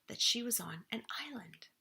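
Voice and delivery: hushed whisper of discovery; awed whisper; whispered reveal. A narrator's reading. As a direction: whisper it like a secret. Big story reveal.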